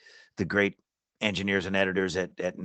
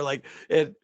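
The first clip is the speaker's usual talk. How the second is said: usual speaking voice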